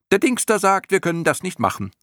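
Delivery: high, piping clown-moth voice; creature calling up. A narrator's reading. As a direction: pass it on in a high, loud squeak. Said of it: "ein anderes rief von unten herauf". (hoch piepsend heraufgerufen)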